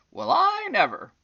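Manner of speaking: high, loud astonished cry; surprised exclamation. Acted. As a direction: burst out in amazement. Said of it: "exclaimed this fairy".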